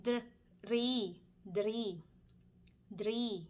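drie with a rolled r, repeated slowly; Dutch drie. Shown as a demonstration. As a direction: model drie for learners. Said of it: "ภาษาดัชก็". (ออกเสียง drie ภาษาดัตช์)

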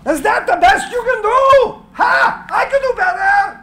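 high, loud defiant shout at the sky; defiant yell at the storm; shouts to the god. Acted. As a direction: challenge the thunder, laughing. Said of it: "shouts to the god".

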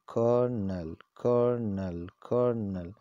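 Cornell said KUR-nel, the L silent in the middle; colonel. Shown as a demonstration. Teacher drills colonel as KUR-nel for viewers. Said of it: "this is the pronunciation of this word".

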